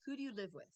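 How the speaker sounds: falling question ending on WITH; WH-question intonation. Shown as a demonstration. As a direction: let "with" drop like a statement. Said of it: "or a W H question".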